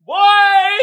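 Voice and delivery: drawn-out high shout; amused outcry. Real, not acted. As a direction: holler it out mid-laughing fit. Cracking up at the meme.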